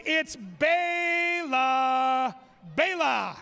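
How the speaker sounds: slow drawn-out name call; announcer hyping the crowd for each corgi; ring announcer intro. Acted. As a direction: stretch the name like a boxing intro.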